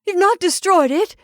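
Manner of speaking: high, loud, urgent question; urgent, worried questioning; anxious demand. A narrator's reading. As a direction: ask with rising anxiety, loudly. Wife anxiously demands the paw.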